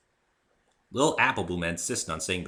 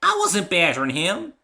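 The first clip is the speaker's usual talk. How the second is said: defensive; 12 semitones higher, 10 dB louder than usual. Acted. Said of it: "said Apple Bloom defensively".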